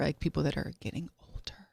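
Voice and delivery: hushed whisper so the producer won't hear; breathy secret whisper. Real, not acted. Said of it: "We won't say it too loud".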